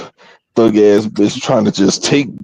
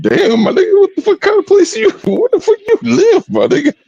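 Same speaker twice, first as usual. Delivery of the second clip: very high, incredulous squeal; retold disbelief. Retelling his question to Bone, high-pitched disbelief. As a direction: ask it aghast, voice way up, laughing.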